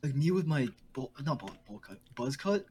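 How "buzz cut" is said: normal talking voice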